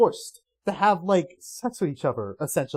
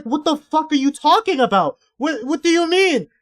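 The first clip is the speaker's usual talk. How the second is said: higher, louder, baffled outburst; incredulous disbelief; 8 semitones higher, 5 dB louder than usual. Retold or imitated. Recalling his reaction to the comic, incredulous and louder. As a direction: demand an explanation in disbelief. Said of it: "I was like".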